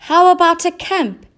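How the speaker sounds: high, loud eager cat; cat with a bright idea. A narrator's reading. Cat's idea.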